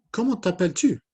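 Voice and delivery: high, loud puppet question; high-pitched character voice; Inès puppet voice. Acted. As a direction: pipe up as a little puppet. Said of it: "Matisse and Inez".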